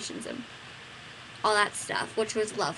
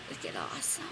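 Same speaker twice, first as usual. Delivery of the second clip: breathy, much lower whisper; 12 dB quieter than usual. Real, not acted. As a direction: whisper it enviously. Jealous about [name].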